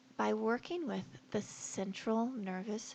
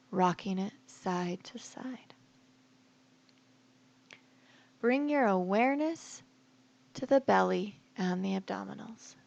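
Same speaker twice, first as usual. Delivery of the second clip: guided meditation; 4 dB louder than usual. Acted. Yoga teacher guiding listeners to relax each body part, guided meditation voice. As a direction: lead them calmly into rest, phrase by phrase.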